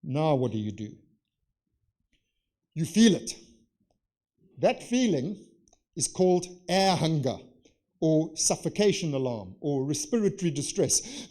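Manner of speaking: ordinary delivery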